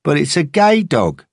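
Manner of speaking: high mocking yob; teasing mate. A narrator's reading. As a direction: call it out as a playground taunt. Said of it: "the other said".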